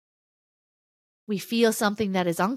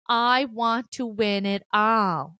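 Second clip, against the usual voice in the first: slow emphatic wish; 3 dB louder, slower than usual. Pretend.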